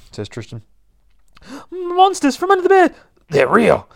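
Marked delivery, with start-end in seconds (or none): 1.7-3.9 very high, loud frightened squeal; cartoonish high-pitched panic; panicked cry. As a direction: shriek in terror at monsters. Tristan panics at the monsters.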